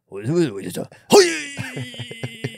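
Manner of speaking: a pretend Japanese man grunting then barking hoi; imitating a macho Japanese voice; mock Japanese shout. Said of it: "lowering your voice".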